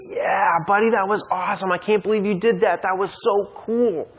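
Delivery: proud dad cheering his son after his brave jump; excited praise. Retold or imitated. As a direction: gush with delight.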